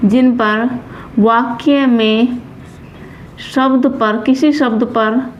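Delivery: slow, word-by-word dictation; halting writing-along pace; dictation while writing. Real, not acted. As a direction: say it in small pieces as you write, with long gaps.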